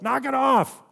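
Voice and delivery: high-pitched yell up the stairs; imitating mother's yell. Mother at the bottom of the stairs, yelling up at kids. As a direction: call it out sharply, as a fed-up parent. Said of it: "she'd yell up".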